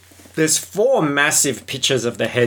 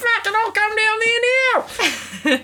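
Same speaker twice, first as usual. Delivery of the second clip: squeaky caricature voice. Retold or imitated. Friend playing a fan calling McAvoy, squeaky high voice. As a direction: pitch it way up like a cartoon mate showing off.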